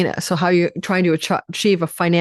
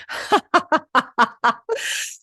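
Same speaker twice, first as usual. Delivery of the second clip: high real laugh at a flubbed word; 10 semitones higher than usual. Real, not acted. Astrologer laughing at her own slip on camera, high laugh. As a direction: burst out laughing at yourself.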